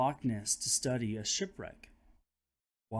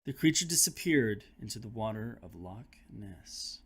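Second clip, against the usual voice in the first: ordinary delivery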